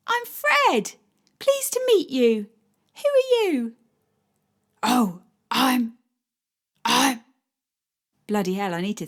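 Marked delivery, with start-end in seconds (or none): 0.1-3.8 put-on much higher eager child voice; a little boy proudly introducing himself; child greeting; small boy. 4.8-7.2 stalling in the dog voice; dog voice lost for words; flustered dog voice. Acted. Dog voice caught off guard.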